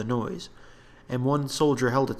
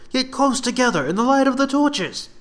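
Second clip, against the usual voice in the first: much higher, loud command; order; 13 semitones higher, 7 dB louder than usual. Acted. Gareth ordering soldiers away from the trees, loud. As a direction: bark it at the troops.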